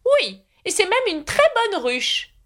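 high, slow, miffed knight; huffy and slow, up high; displeased. A narrator's reading. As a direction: knight offended about his beehive. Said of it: "d'un ton mécontent".

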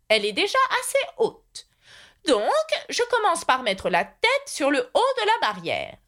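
high-pitched knight explaining step by step; eccentric knight, earnest inventor. A narrator's reading. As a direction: lecture earnestly, stretching key words in a high voice. (chevalier inventeur qui explique, voix haut perchée)

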